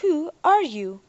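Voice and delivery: the central computer questions Derek in a slow computer voice. A narrator's reading.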